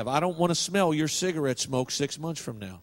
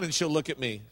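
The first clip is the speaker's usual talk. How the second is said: ordinary talk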